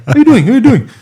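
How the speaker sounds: high, loud acted hail; shouted greeting. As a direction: play-act hollering hello to the boys. Acts out hailing the Fijian boys.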